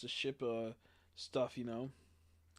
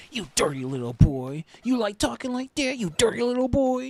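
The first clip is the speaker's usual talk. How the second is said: loud, high put-on scolding voice; scolding old teacher; 9 semitones higher, 10 dB louder than usual. Eighty-year-old teacher scolding a student at his desk, loud and high. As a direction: lean over the desk and scold him gleefully. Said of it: "looks at him and goes".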